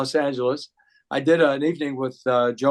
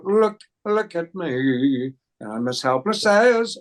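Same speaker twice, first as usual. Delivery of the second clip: slow, high crooning in [name]'s style; [name] impression; 3 semitones higher, slower than usual. Singer at Merv's party crooning like [name]. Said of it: "I turned into [name]".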